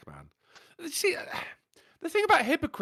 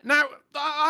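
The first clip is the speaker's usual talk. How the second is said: ordinary delivery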